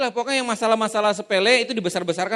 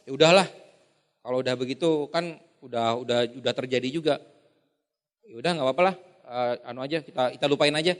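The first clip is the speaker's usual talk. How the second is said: dismissive shrug; 7 semitones lower than usual. Retold or imitated. Sanguine brushing it off.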